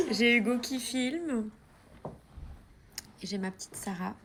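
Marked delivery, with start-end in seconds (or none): 0.0-1.5 high laughing, slow words. Real, not acted. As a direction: laugh through the line. Laughing while introducing vlog.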